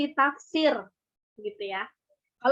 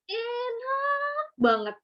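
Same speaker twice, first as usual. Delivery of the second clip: much higher, slow, stretched "Enak banget"; drawn-out enak banget; 12 semitones higher, much slower than usual. Shown as a demonstration. Showing how spoken praise sounds, drawn out high. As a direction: savour it slowly and high. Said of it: "lebih nyerep kalau kita ngomong langsung".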